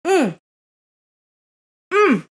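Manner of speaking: high hum of enjoyment; pleased hum. Real, not acted. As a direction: hum with delight at the taste. Tasting the food.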